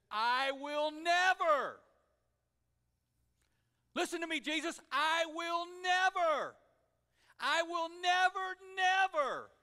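loud, slow, emphatic protest; insistent vow. Acted. Peter vowing to Jesus he will never deny him, loud and insistent. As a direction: swear it, leaning hard on never.

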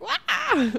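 high mock wail, like a sob; playful imitation of bursting into tears; mock crying wail. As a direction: let out a hammed-up crying wah. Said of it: "And I just started crying".

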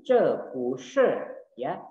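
ordinary delivery

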